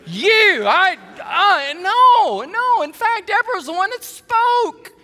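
high, loud, flustered denial; emotional protest. Real, not acted. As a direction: blurt it out, overcome with emotion.

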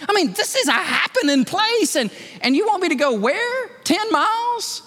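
high incredulous whine; reluctant protest. Retold or imitated. Peter balks at leaving the revival, high and incredulous. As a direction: balk at being asked to leave.

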